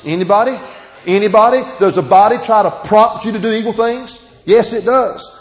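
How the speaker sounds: loud, high call to the room. Real, not acted. Preacher calling out to the congregation, loud and high. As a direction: rouse the congregation.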